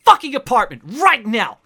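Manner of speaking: high, loud, agitated outburst; outraged apartment owner. Acted. Apartment owner ordering intruders out and demanding evidence, high and outraged.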